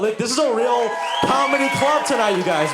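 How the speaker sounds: high, loud crowd hype; hyped-up shout. Real, not acted. Comic hyping the Lowell crowd, high excited shout. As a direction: pump up the crowd.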